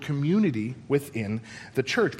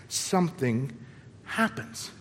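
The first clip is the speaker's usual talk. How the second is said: hushed reveal; 3 semitones lower, 6 dB quieter, slower than usual. Acted. Pastor hushing the congregation for a dramatic reveal. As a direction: drop to a breathy near-whisper on happens.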